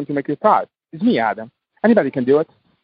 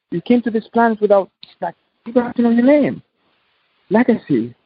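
ordinary talk

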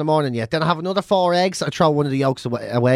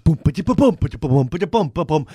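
imitating drum bands. Mocking hippie drum circles heard across town, mouth drumming. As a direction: mouth drumming boom ba-da-bum. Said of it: "you hear all these fucking hippies".

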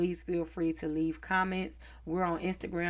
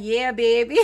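put-on New Orleans accent; 5 semitones higher, 10 dB louder than usual. To a New Orleans friend, mimicking their New Orleans accent. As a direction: slip into a New Orleans accent like you caught it. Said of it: "talking to somebody from New Orleans".

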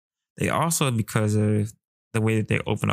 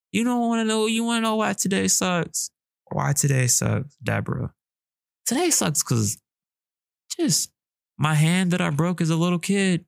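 playful high voice; 8 semitones higher than usual. Pretend. Acted friend asking Deborah.

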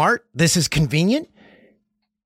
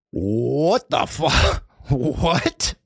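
slow disbelief; much slower than usual. Real, not acted. Disbelief at raw fries order.

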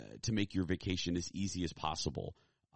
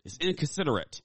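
higher quoted "It's inconsiderate."; viral video quote; 9 semitones higher, 4 dB louder than usual. Retold or imitated. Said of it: "as one of my favorite viral videos said".

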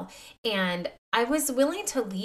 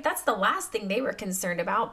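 usual speaking voice

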